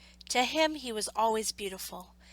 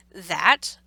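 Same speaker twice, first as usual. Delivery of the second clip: louder, lower dismissive that; a louder, lower disdainful doctor; scornful doctor; 3 semitones lower, 12 dB louder than usual. A narrator's reading. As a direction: sneer at the toy. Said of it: "said the doctor".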